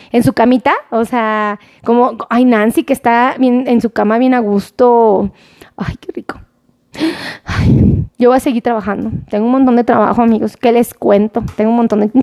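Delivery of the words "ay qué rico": whisper. Real, not acted. Imagining someone cozy in bed.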